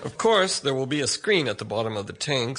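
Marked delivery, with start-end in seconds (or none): none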